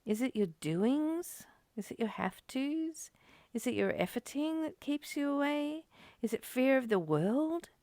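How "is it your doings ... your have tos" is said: normal talking voice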